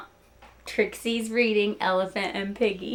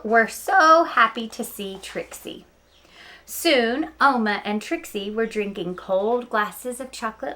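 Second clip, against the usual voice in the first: lively read-aloud storytelling; story narration; 5 dB louder than usual. Acted. Teacher reading Knuffle Bunny to her students, storytelling voice. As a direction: tell it like story time for kids.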